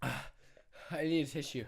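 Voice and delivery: slow, soft and teary. Real, not acted. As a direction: sniffle it out through tears. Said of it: "I'm crying".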